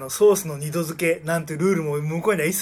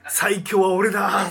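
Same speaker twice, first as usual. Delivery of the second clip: mock boast shout; 4 semitones higher, 6 dB louder, slower than usual. Mock boast of manga character.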